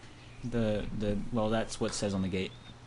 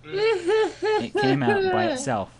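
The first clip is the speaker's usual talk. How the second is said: much higher and slow, words squeezed through laughter; laughing nervously; 5 dB louder, much slower than usual. Acted. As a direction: laugh the words out, unable to stop. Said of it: "I gave a nervous chuckle".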